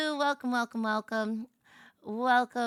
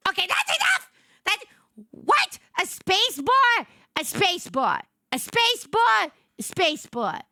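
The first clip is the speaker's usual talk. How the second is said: high, loud exasperated outburst; irrationally angry; 8 semitones higher, 4 dB louder than usual. Real, not acted. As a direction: blurt it in comic outrage, peaks jumping high. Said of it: "made me so irrationally angry".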